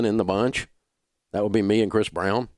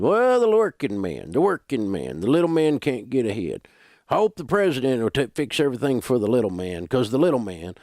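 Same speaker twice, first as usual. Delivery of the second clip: mock whine; 4 semitones higher than usual. Radio host mimicking people who blame the system, whining. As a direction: whine it like a hammed-up complainer. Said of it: "they always whine when they're saying it".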